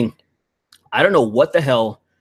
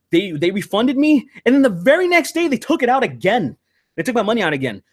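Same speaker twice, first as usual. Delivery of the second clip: much higher, climbing in disbelief; rising indignant rant; 8 semitones higher than usual. Real, not acted. Telling his friend how the refund was taken back, indignant and much higher. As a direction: build outrage as the story turns. Ranting about stolen refund.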